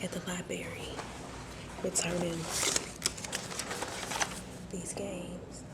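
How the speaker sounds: hushed whisper in the library; quiet whispered tour of the shelves. Real, not acted. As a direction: keep it down, whisper so nobody hears.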